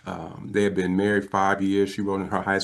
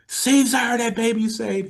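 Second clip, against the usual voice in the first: very high imitated call to a husband; screaming; 14 semitones higher than usual. Wife summoning her husband to the phone, mock screaming. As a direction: holler it like an overjoyed wife. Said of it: "she was screaming".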